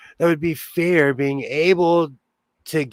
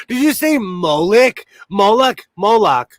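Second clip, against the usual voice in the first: surprised; 5 semitones higher, 5 dB louder than usual. Real, not acted. Surprised at a chat comment.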